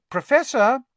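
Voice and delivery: loud, high call. A narrator's reading. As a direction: raise the voice to wake a sleeper. Calling louder to wake sleeper.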